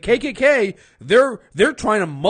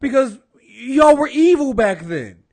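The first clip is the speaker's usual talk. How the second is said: heated retort; 5 semitones higher, 5 dB louder than usual. Retold or imitated. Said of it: "I'm like".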